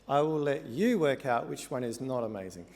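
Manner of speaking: normal talking voice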